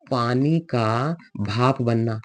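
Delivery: at half speed, word by word; drawn-out, deliberate teacher phrasing; slow dictation. Real, not acted. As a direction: spell it out slowly for the class. Teacher slowly dictating an example.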